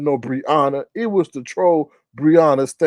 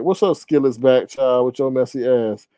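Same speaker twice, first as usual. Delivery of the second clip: normal talking voice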